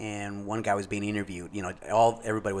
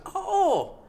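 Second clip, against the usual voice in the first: much higher, rising astonished oh my goodness; amazed; 4 dB louder, much slower than usual. Retold or imitated. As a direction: struck by the surprise. Learns cousin connection, amazed.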